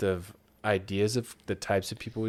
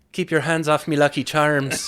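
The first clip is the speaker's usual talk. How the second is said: put-on loud, higher Irish leprechaun accent; 7 semitones higher, 9 dB louder than usual. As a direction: lay on a thick Irish brogue. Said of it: "let's hear your Ireland accent".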